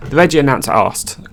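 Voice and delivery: ordinary delivery, nothing marked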